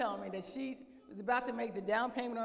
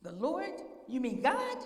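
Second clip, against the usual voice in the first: higher, rising disbelief; astonished; 3 semitones higher, slower than usual. Acted. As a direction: question it as it dawns on you. Jack astonished at who speaks.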